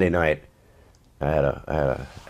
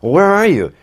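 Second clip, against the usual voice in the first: much higher, louder exasperated demand; a woken-up director who has had enough; 10 dB louder than usual. Retold or imitated. Director Wes, woken late at night, asks exasperated. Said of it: "so he's exasperated".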